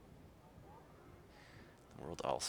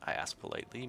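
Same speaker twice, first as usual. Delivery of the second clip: ordinary delivery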